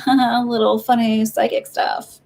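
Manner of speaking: slow, teasing mockery; mocking. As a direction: sneer it like a dismissive partner. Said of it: "making fun of you".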